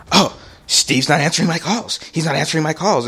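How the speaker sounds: high, agitated mock mom; freaking out. Son mimics mom fretting over unanswered calls, freaking out. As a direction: imitate a panicked, frantic complaint. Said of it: "She freaks the hell out".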